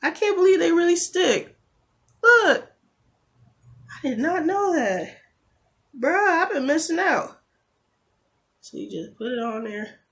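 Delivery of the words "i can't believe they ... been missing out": high, loud thrilled exclaiming; excited disbelief. Real, not acted. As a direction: show off the discovery. Thrilled the tips stick.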